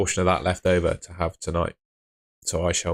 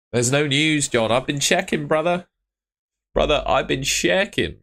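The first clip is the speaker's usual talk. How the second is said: high insistent; 7 semitones higher than usual. Real, not acted. Insisting there's no news.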